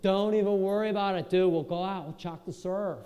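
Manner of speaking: surfer bro reassurance. Retold or imitated. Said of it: "They're like".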